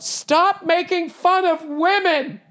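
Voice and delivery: high, slow mock-shrill heckle; heckler yelling. Comic imitating a woman heckling him, high slow yell. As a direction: play the offended heckler calling out. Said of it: "she at one point just yelled out".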